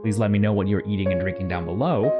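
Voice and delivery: ordinary delivery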